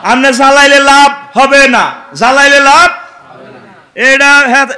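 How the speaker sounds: loud, high fiery sermon; preaching at full force. Acted. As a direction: drive it at the crowd. Preaching to crowd.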